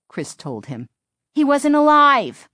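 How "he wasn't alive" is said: put-on high, loud, insistent girl; girl's voice. A narrator's reading. Chris assuring the driver about the dummy, insistent girl's voice.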